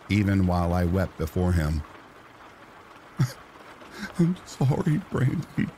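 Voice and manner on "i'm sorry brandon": sympathetic softness. A narrator's reading. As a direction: comfort him gently as he dies. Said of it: "with sympathetic softness".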